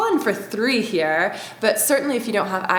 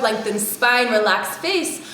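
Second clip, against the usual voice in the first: ordinary talk, nothing marked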